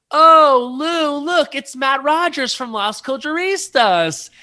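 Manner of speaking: very high, loud mock-excited call; gushing introduction. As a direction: call out to the star, high and drawn out. Acts out director's call to Lou.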